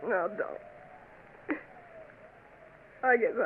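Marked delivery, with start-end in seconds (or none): 0.1-1.6 whispered, hurt denial; broken whisper. Acted. Babette stunned by the news, whispering. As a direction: barely get it out. Babette hears of Jake's wedding.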